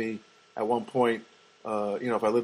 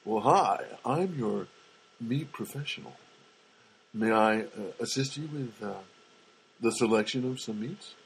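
low, lingering mock come-on; suggestive; 3 semitones lower than usual. Meat professional assisting a customer, suggestive. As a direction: offer help with teasing innuendo, pausing between phrases. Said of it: "you know, suggestive".

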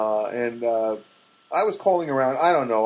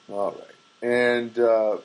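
ordinary delivery

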